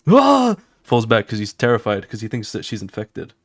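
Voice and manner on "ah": high yelp of fright; a sudden high frightened yelp; startled yelp. Retold or imitated. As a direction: yelp as he falls back in fright. Character falls back terrified.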